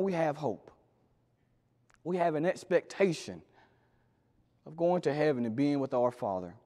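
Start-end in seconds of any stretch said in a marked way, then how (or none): none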